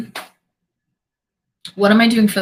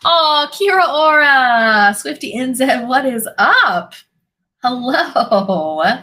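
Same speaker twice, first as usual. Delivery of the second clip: drawn-out, bright, louder hello; excited greeting; 5 semitones higher, 3 dB louder, much slower than usual. Real, not acted. Welcoming viewer Swifty on stream, excited. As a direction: greet a friend joyfully and slowly.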